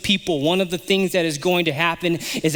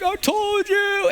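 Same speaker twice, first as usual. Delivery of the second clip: much higher, slow singsong I told you; gloating told you; 14 semitones higher, slower than usual. Retold or imitated. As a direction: rub it in, drawn out. Division group voiced gloating.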